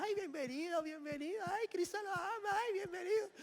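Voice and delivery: over-sweet high church-greeter voice; mock sugary welcome. Church crowd greeting newcomers.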